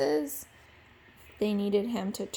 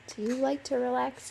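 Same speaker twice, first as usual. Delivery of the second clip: ordinary delivery, nothing marked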